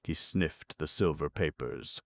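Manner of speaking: ordinary delivery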